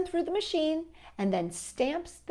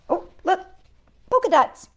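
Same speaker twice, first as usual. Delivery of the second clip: delighted; 9 semitones higher, 9 dB louder, faster than usual. Real, not acted. Delight at book cover.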